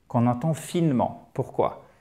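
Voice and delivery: normal talking voice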